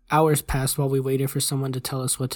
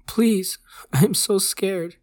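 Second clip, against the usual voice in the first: high, slow, frightened plea; scared pleading; 5 semitones higher, 4 dB louder, much slower than usual. A narrator's reading. Dying kid to his doctor, pleading in fear. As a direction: plead in fear, pitched up and drawn out. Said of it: "he started to lose his composure".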